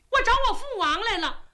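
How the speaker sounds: high, quick and imperious, demanding entry; a spoiled princess ordering the guard about; high, brisk haughty princess voice. Acted.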